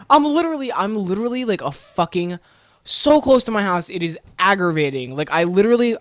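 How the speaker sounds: high, worked-up protest; exasperated. Retold or imitated. Exasperated rant about being towed.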